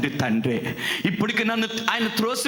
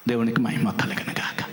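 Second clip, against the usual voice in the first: whisper; 11 semitones lower than usual. Real, not acted. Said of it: "hushed praise".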